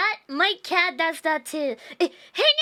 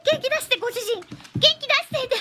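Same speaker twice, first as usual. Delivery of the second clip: cute dog voice; 7 semitones higher, much faster than usual. Pretend. Voicing the dogs' reaction.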